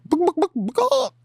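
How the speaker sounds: very high squeaky "But"; comic falsetto interjection; squeak. Pretend. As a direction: jump way up into a squeak. Reacting to scene, squeaky.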